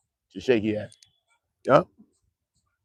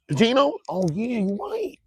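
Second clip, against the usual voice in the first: high mock voice; 9 semitones higher, 4 dB quieter than usual. Playing both sides.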